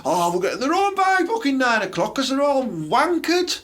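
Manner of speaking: high mocking lad voice; mock drunk lad. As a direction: ham up a lad already drunk by nine.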